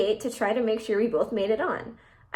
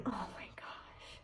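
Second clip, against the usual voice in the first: whisper; 21 dB quieter, much slower than usual. Real, not acted. Horrified reaction to the post.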